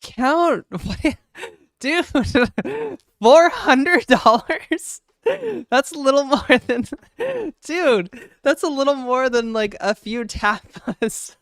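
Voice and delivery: loud, high, laughing disbelief; shouting in real shock at the tip; stunned surprise. Real, not acted. Streamer reacting to big donation, loud high astonishment.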